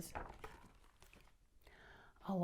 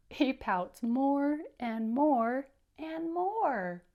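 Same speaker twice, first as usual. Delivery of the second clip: very slow, louder, stretching each more; slow, drawn-out pouting; 4 semitones higher, 5 dB louder, much slower than usual. Acted. Reader stretches out the fish's pouting for the children. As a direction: drag out every more. Reader acts out growing pout.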